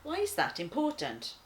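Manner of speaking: usual speaking voice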